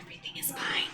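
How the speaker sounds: a soft unvoiced whisper to calm your own nerves; hushed whisper that everything is fine; whispered reassurance. Real, not acted. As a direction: whisper it to yourself, barely there. Self-soothing mid-baking mishap.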